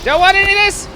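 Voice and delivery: high loud panicked yell; panicked shout. Real, not acted. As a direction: yell it while running away. Fleeing danger.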